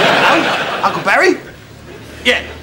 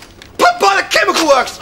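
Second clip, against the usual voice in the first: higher-pitched, exasperated repeat; 5 semitones higher than usual. Acted. Landlord hunting for the pub's name, exasperated. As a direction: let the frustration peak on this try. Third try, frustration peaks.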